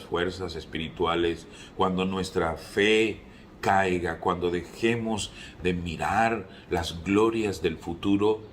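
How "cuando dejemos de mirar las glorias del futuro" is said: normal talking voice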